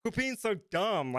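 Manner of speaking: high, drawn-out dad scolding; mock parental reprimand, slow and high; exasperated dad. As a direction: plead like a dad at wit's end. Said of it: "like, a dad".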